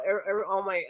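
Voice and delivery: usual speaking voice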